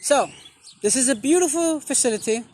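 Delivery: usual speaking voice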